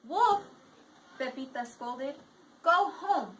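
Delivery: lively read-aloud voice for the class; reading to children. A narrator's reading. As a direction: read the picture book to students. Teacher reads story aloud.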